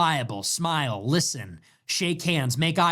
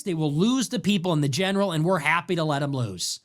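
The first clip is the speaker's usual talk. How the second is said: ordinary talk, nothing marked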